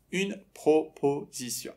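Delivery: "proposition" slowly split into syllables. Shown as a demonstration. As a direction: say "proposition" in separate, slow beats. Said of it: "باید اینا رو سیلاب به سیلاب".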